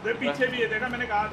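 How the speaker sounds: loud warning. Real, not acted. Warns of enemies behind.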